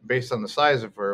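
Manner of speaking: ordinary delivery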